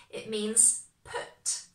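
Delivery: slow right down to land the word put; slow emphasis. Shown as a demonstration. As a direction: put said slowly and firmly. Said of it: "when we use it with this pattern".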